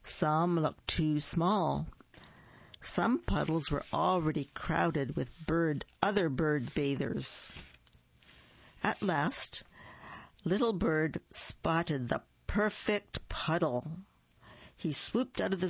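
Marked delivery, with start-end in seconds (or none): none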